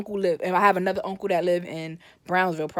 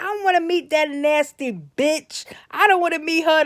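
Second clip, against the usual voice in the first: indignant refusal; 6 semitones higher than usual. Retold or imitated. Fed-up grandma refusing her son's girlfriend, indignant. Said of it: "My grandma was like".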